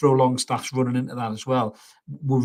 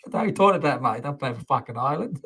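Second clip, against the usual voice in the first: mock Cockney, played for laughs; a hammed-up Cockney accent, half-laughing. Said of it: "talking with, like, a, like, a Cockney accent".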